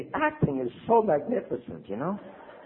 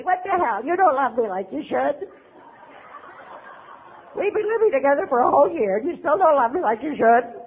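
higher, aggrieved whine; mock whiny complaint; 7 semitones higher than usual. Addict accusing partner of not loving enough, mock whine. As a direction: sound hurt and owed.

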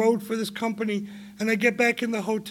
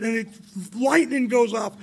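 ordinary delivery

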